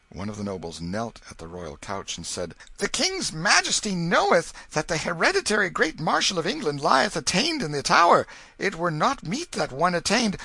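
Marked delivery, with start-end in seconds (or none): 2.8-10.4 kneeling noble. A narrator's reading. Noble pleads at the couch.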